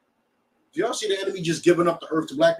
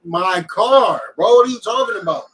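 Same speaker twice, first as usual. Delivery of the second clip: incredulous; 4 semitones higher, 7 dB louder than usual. Retold or imitated. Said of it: "He like".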